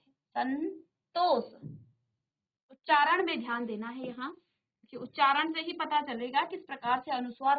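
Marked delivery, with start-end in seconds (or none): 0.1-1.4 संतोष stretched out, with the half-n held before तोष; very slow demonstration. Shown as a demonstration. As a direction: stretch संतोष to let the n ring. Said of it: "आधा नौ का उच्चारण आप कर रहे हैं".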